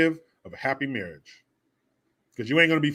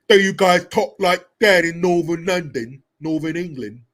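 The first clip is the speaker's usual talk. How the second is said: put-on English accent; 4 semitones higher, 7 dB louder than usual. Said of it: "I gotta get my English accents".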